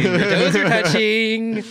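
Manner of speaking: high, slow teasing sing-song. Pretend. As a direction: stretch it out to tease. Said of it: "repeat drawn out".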